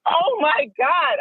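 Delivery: high, drawn-out mock scream without the volume; retold excited scream. New hire running out of the trailer, mock excited scream. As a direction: stretch it out in high, overjoyed disbelief. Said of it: "I literally ran out her trailer screaming".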